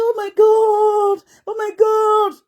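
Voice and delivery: high, drawn-out "oh my God"; mock bragging. Imitating people who come online to brag, high and drawn out. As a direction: stretch it out high, parodying a show-off. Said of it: "just come and say".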